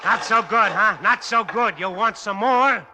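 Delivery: high, loud jeering; mock the beaten thing; manic taunting. Taunting the broken giant.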